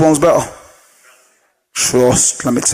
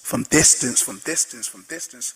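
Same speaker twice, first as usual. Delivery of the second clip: each repeat much softer than the last; fading into distance; 4 semitones lower, 10 dB quieter than usual. Shown as a demonstration. Trainer showing belief from afar, fading away. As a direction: drop away to almost nothing.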